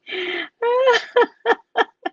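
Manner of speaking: high, loud cackling laugh; high laughter. Real, not acted. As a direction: laugh hard at the story. Laughing at own story.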